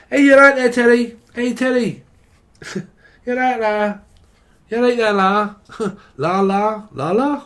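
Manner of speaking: high, loud Scouse calling out; Scouse accent. Pretend. Scouser checking his mate is alright, loud Scouse call. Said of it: "This is a Scouser".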